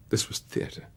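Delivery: ordinary talk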